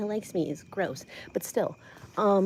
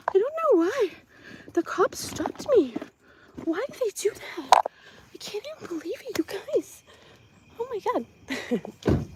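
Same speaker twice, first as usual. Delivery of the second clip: much higher, whining disbelief; mock whiny victim; 10 semitones higher than usual. Mocking Heather acting shocked the cops stopped her, whiny and high. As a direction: play the baffled innocent, pitched way up. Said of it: "she's like".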